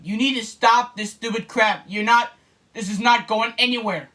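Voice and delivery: ordinary delivery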